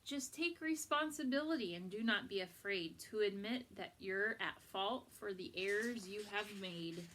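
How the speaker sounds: ordinary delivery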